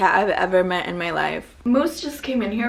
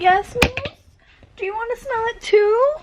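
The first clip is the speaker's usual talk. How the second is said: high, louder pet-talk voice; high sing-song talk to a pet; 11 semitones higher, 5 dB louder than usual. Real, not acted. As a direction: coo up high at the cat. Baby talk to the cat.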